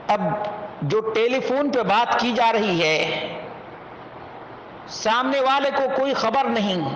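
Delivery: ordinary talk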